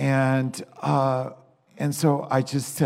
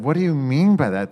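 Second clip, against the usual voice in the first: exasperated demand; 3 semitones higher, 8 dB louder, faster than usual. Retold or imitated. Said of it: "I said".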